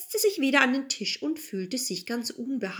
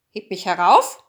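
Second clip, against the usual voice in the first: loud, lower-pitched frog demand; threatening frog; 3 semitones lower, 8 dB louder than usual. A narrator's reading. Frog crawls to the bed and threatens, loud and low. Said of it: "kam er gekrochen und sprach".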